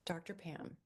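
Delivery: ordinary talk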